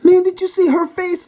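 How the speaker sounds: high, giddy question through laughter; still laughing. A narrator's reading. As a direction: gasp it out mid-laugh. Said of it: "still laughing".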